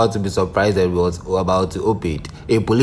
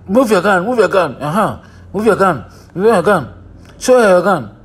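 urgent commands; 6 semitones higher than usual. Retold or imitated. Telling the actors in the clip to grab her, high urgent calls. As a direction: egg them on like a frantic director. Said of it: "And I said".

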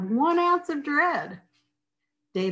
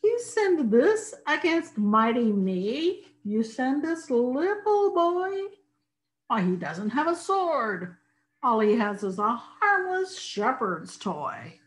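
giant voice; 5 semitones higher than usual. Acted. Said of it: "said Goliath".

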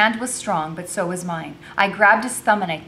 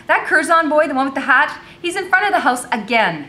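higher, louder exasperated report; scowling complaint; 9 semitones higher, 8 dB louder than usual. A narrator's reading. Becky complaining about the boy outside, exasperated. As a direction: complain about an unwelcome visitor. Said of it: "Becky entered the kitchen with a scowl".

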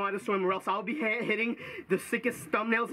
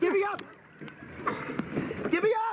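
higher, frantic pleas to be lifted; desperate calls for help; 7 semitones higher, faster than usual. Real, not acted. Panicked swimmer begs friends to pull him up, desperate. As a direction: yell for rescue. Begging to be pulled up.

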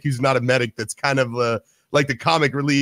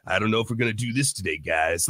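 low, quiet cowboy mumble, quick; cowboy accent dropped low and cool; cool-guy cowboy accent; 7 semitones lower, 5 dB quieter than usual. Retold or imitated. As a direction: imitate a laid-back cowboy pilot. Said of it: "like, a cool guy accent".

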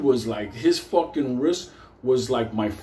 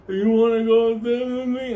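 put-on high, louder coaxing baby talk; 9 semitones higher, 4 dB louder than usual. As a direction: lure him like a small child.

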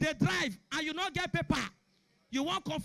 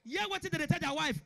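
ordinary talk

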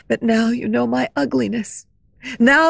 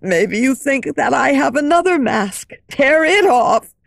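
louder, raging tormented shout; 4 dB louder than usual. Acted. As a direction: scream at her in torment. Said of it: "He was shouting".

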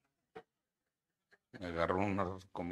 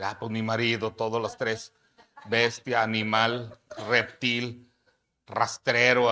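much louder, hammed-up jab; mock complaint; 2 semitones higher, 14 dB louder, slower than usual. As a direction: shout out each insult for a laugh. Wife joking husband is a beast.

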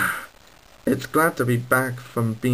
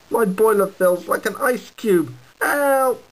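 higher, distressed little engine; 7 semitones higher than usual. Acted. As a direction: cry out, freezing and scared. Cold engine calling for help.

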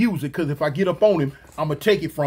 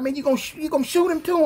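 startled question; 8 semitones higher, faster than usual. Retold or imitated. Friend asks if he'll shoot the guy, alarmed and higher. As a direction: pitch jumps higher in alarmed disbelief.

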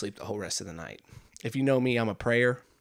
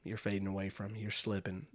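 hushed farewell; 2 semitones lower, 12 dB quieter than usual. Retold or imitated. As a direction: drop the volume as if at a deathbed. Husband's mock farewell to fading wife.

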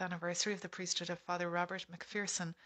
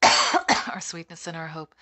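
loud cough breaking into the words; slow words after a hacking cough; chesty cough; 16 dB louder, slower than usual. Real, not acted. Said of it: "Sorry, I'm coughing".

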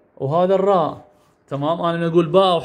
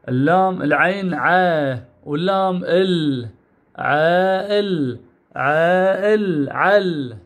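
عائل drawn out very slowly; slow sounding out; much slower than usual. Shown as a demonstration. Teacher stretching عائل slowly for the class. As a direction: stretch each sound as you join them. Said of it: "بهذا الشكل".

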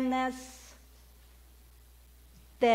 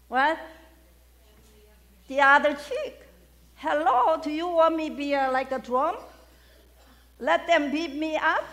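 mocking loud objection; 6 semitones higher, 5 dB louder than usual. Preacher mocks turning other cheek.

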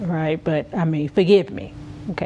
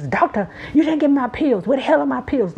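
frantic accusing; 6 semitones higher, faster than usual. Retold or imitated. Etta frantically accusing doctors.

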